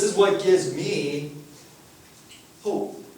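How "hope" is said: ordinary delivery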